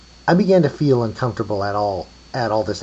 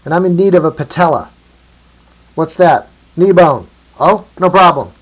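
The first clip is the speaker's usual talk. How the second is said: high, loud hollered exchange; calling across distance; 8 semitones higher, 12 dB louder than usual. A narrator's reading. Traders sort out a patella, calling out. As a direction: shout it so it carries.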